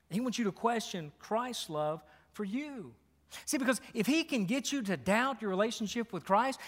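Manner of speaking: ordinary delivery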